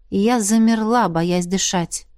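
ordinary delivery, nothing marked

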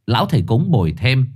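ordinary delivery

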